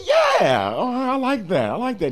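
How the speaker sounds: thrilled high yeah; bursting with delight; excited. Retold or imitated. Richie excited by the change.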